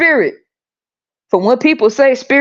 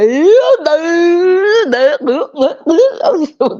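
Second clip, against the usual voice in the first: laugh; 8 semitones higher than usual. Retold or imitated. Congregation reacts to the preacher.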